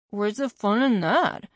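mumbling through a mouthful of cookie; chewing while talking; mouth full. A narrator's reading. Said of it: "I mumbled with a mouthful".

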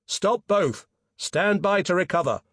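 shouted order. A narrator's reading. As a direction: call engine orders out sharply. Peter calling engine orders.

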